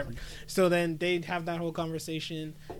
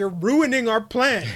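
scolding; 5 semitones higher, 6 dB louder than usual. Retold or imitated. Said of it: "Mikasa's like".

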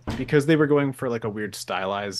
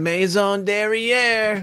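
put-on slow, high, posh French accent; fancy French accent; 10 semitones higher, 4 dB louder, much slower than usual. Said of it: "she's like".

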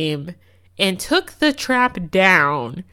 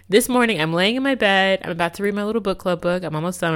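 normal talking voice

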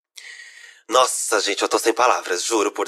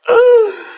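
shocked gasp; 8 dB louder than usual. Real, not acted. Shocked by story twist.

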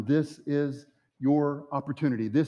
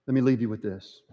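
ordinary talk

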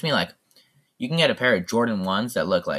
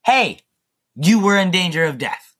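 higher, louder call-out of hey; exasperated retort; 9 semitones higher, 6 dB louder than usual. Real, not acted. Podcaster retorting to the quoted man, exasperated. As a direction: push back hard at the absent man.

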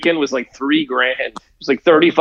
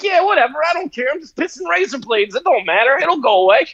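higher-pitched, mock dismissive patient; Al Capone; 8 semitones higher than usual. Gangster brushing off advice to get the shot, mock careless. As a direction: wave it off in a put-on high voice. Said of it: "he's like".

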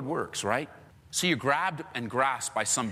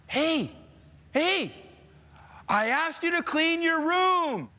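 higher, slower and drawn-out fed-up dad; exasperated dad; 12 semitones higher, slower than usual. Retold or imitated. Dad calling up to the kids about the room, slow and fed up. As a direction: stretch it out like a parent losing patience.

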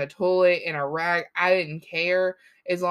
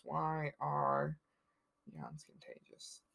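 much softer, trailing into an unvoiced murmur; muttered to herself; 3 semitones lower, 13 dB quieter, slower than usual. Real, not acted. Muttering the co-host's question into a search bar. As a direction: mutter "yawns contagious" under your breath as you type. Typing the question while reading.

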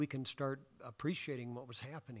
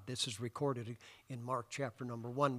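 ordinary delivery

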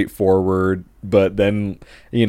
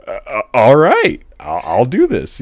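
unsure reply; 5 semitones higher, 5 dB louder than usual. Retold or imitated. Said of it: "I'm like".

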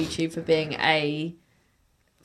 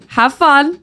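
cheery sign-off; 8 semitones higher, 6 dB louder than usual. Real, not acted.

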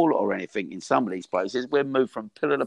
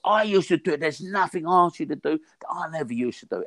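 higher, pushing trainer voice; rally tired boxers from a higher pitch; coach's rallying cry; 7 semitones higher than usual. Retold or imitated. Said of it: "I go".

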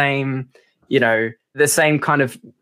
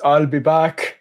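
put-on lightly hinted Austrian-accented action hero line; a quick mock Terminator catchphrase; Arnold Schwarzenegger; much slower than usual. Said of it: "that was a bit Arnold Schwarzenegger".

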